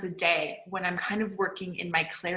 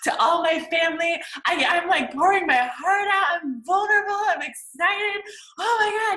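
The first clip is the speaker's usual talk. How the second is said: high, louder gushing Facebook post; 7 semitones higher, 4 dB louder than usual. Retold or imitated. Poster announcing dreams to family on Facebook, gushing high and loud. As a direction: pour it out all excited. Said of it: "you set out and you're writing this".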